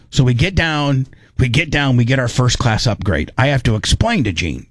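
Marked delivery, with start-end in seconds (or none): none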